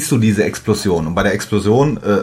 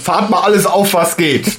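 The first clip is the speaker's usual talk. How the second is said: military shout; 9 semitones higher than usual. Retold or imitated. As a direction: roll out everything, shouting. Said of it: "so typisch Unit-mäßig".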